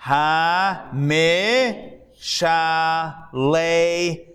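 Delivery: "Hamishaleach" sounded out very slowly; "Hamishaleach" drawn out one syllable at a time. Shown as a demonstration. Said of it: "the mem there is, you go eh".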